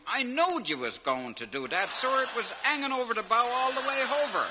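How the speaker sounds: put-on high, fast, laughing country-boy voice; naive George. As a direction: rattle it off high and gleeful. George answers the captain.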